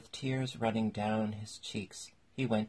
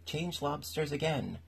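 high-pitched, understated storybook yell; mild yell; 7 semitones higher than usual. A narrator's reading. Gryphon calls out the next dance step, mild high yell. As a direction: yell it high without much volume. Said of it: "at the top of its voice".

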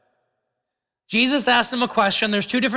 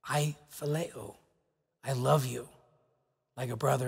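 humble quiet answer; 6 semitones lower, 10 dB quieter than usual. Acted.

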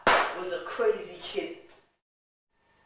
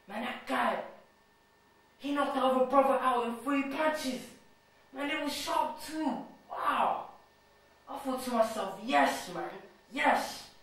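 higher-pitched, thrilled admiration; awed excitement; 5 semitones higher than usual. Acted. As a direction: relive the knockout with rising excitement. Awed recalling a friend's knockout win.

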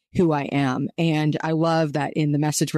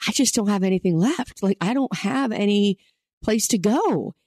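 higher, worn-out voice of someone with nothing left; weary and spent; 5 semitones higher than usual. Retold or imitated. A struggling believer at the end of their rope, higher and weary. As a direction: sound like you've hit the end of your rope. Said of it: "when you're like".

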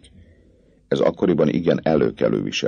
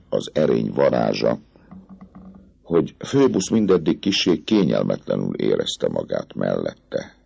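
plain reading voice

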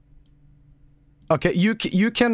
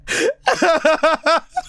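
high wheezing laugh; 10 semitones higher, 4 dB louder than usual. Real, not acted. Streamer keeps laughing at clip.

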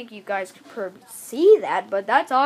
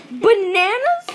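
surprised; 7 semitones higher, 7 dB louder, slower than usual. Real, not acted. Surprised about bananas.

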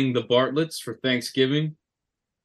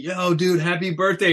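mock excited friend; 7 semitones higher, 4 dB louder than usual. Imitating friends texting birthday wishes.

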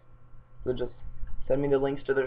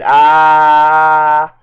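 huge loud battle yell; fireball shout; 3 semitones higher, 17 dB louder than usual. Acted. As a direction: let out a big fireball cry. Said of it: "So he shouted".

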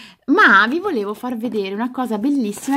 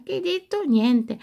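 ordinary talk, nothing marked

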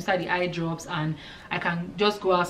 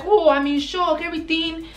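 mock shocked patient; 10 semitones higher, 3 dB louder than usual. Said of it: "the baby was like".

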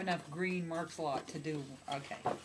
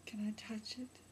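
breathy whispered request, leaning in close; creepy whisper; 8 dB quieter than usual. Acted. Whispering to the pregnant friend, creepy and hushed. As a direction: whisper it like a creepy would-be parent. Acting out creepy Juno scene.

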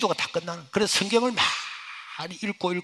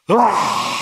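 a loud, wailing burst of sobbing; retold wail; 3 semitones higher, 9 dB louder, much slower than usual. Overwhelmed at dawn, he bursts into a loud wail. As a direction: let out a sudden crying wail. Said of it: "하고 울음다".

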